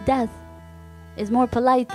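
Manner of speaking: usual speaking voice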